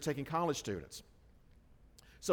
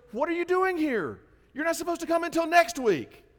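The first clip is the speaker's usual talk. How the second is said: much higher, louder, caught off guard; startled camp manager; 14 semitones higher, 6 dB louder than usual. Retold or imitated. Camp manager shocked to see the group early, high and loud. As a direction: blurt it out in sudden surprise.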